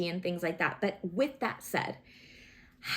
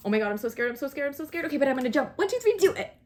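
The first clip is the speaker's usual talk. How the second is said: scared then bold; 4 semitones higher, 4 dB louder, faster than usual. Retold or imitated. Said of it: "It's like".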